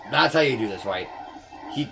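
ordinary talk

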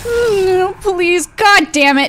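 high wail sliding down to a plea; loud, whiny begging that drops in pitch; exasperated pleading. Real, not acted. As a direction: beg to be left alone. Begging the game to leave her.

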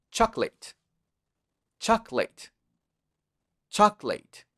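chocolate joined in two syllables, chok-let; correct pronunciation. Shown as a demonstration. Teacher modelling for repetition, two-syllable chocolate. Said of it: "Que no es así, es chocolate".

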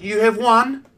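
put-on high kid voice; kid announcing. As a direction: pitch it up like the boy. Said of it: "And he's like".

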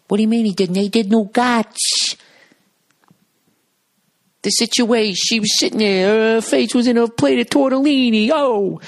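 high, loud mocking Jersey guys; imitating the housemates ranting about Mike; housemates' impression. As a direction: crank it way up high and loud, outraged gossip. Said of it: "other guys in the house going".

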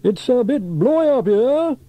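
high, loud, stretched-out shout; shouting over wind. A narrator's reading. Sam atop the spire in the wind, shouting. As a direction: shout against a howling gale.

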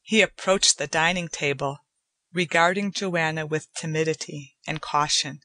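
usual speaking voice

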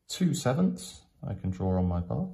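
ordinary delivery

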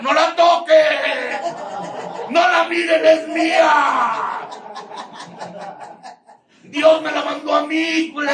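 screaming high and loud, possessive; jealous shout. Retold or imitated. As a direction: yell it out like a jealous drunk who won't let go. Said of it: "Y hasta quisieras gritar". (gritando celoso, muy alto y fuerte)

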